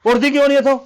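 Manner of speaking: fast, loud, rising yell at the wife; husband yelling at wife for neglecting his brother; angry scolding. Acted. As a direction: bark orders at her to get up.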